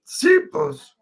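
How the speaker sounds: high airy Russian meerkat squeak; Oleg meerkat voice. Retold or imitated. Streamer doing the meerkat advert voice for viewers. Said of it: "the meerkat".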